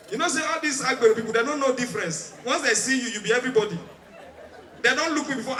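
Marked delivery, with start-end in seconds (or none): none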